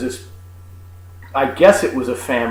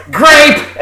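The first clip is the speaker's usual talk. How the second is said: loud, high imitated yell; mock angry call-out; angry wench yell; 11 semitones higher, 6 dB louder than usual. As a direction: bellow it like a fed-up teenage server. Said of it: "angry teenage wench comes out and yells".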